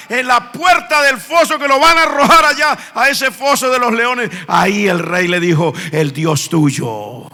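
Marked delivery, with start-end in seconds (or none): 5.9-7.3 a low, slow, hushed king's voice; low and slow, like a grave king; king's blessing. Retold or imitated. King speaks to Daniel. (rey solemne, grave y lento)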